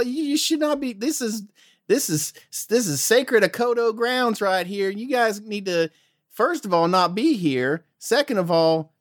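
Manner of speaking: high folksy guard voice; Southern guard. Acted. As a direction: question strangers like a suspicious Southern sentry. Guard questions the intruders.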